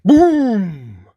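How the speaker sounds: big, loud, high-swooping boom; thunderous boom. A narrator's reading. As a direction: let the word explode like thunder. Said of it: "Such a thunderous sound".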